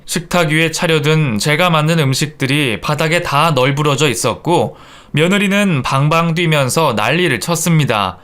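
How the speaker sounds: usual speaking voice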